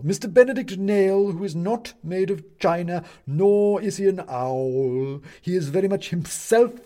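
high, loud, declamatory voice; pompous owl judge. Acted. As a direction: sum up the witness grandly for the court. Owl sums up witness.

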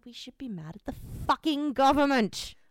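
real angry outburst. Real, not acted. Friend ranting about who deserves blame, angry and loud. Rant turns on the government.

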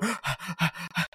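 quick soft excited gasp; gasping at his girlfriend; sharp gasp. Retold or imitated. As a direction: suck in air in delight.

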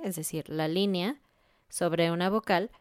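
usual speaking voice

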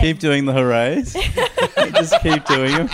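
laughing through the words; giggling as you say it. Real, not acted. Laughing suggestion.

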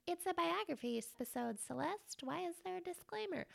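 high, soft mock complaint; questioning listener. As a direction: imitate a puzzled listener in a small high voice. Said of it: "you're probably like".

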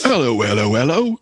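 British caricature, rising high at the end; mock British accent. Co-host joining the British bit, mock British accent. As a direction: greet like a comic British bobby.

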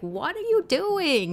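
high, drawn-out mock parental dismay; exasperated parent. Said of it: "your parents are like".

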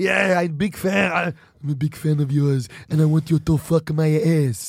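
put-on deadpan request in a thick Austrian accent; Arnold Schwarzenegger. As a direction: ask earnestly as the action star. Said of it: "Arnold, I can't".

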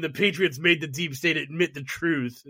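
ordinary delivery

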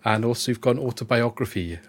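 normal talking voice

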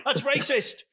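high, playful fake shock; mock outrage. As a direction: pretend to be scandalised. Jokey accusation after accent joke.

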